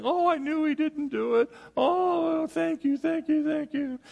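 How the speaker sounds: high, tearful gushing mother; hammed-up overjoyed mom on the phone; imitating relieved mom. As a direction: gush with mock-weepy gratitude in a high voice.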